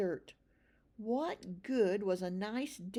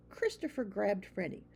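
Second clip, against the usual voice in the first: plain reading voice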